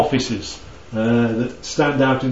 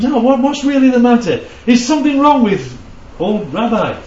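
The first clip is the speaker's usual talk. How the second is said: higher, pressing question, lightly voiced; insistent questioning; 8 semitones higher than usual. Mourners pressing the young rabbi, lightly voiced and insistent. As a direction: push them for the truth. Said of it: "everybody else says".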